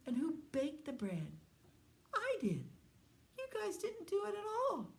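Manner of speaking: high, indignant storybook hen; scolding hen. A narrator's reading. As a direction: pitch it up and demand each answer. Hen scolds friends who didn't help.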